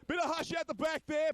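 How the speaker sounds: calling out. Real, not acted. Asking noisy crowd for quiet.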